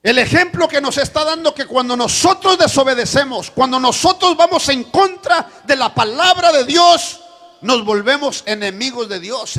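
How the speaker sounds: loud, high sermon delivery; full-force preaching. Acted. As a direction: drive the lesson home from the pulpit. Preacher applies lesson loudly. (predicación a todo pulmón)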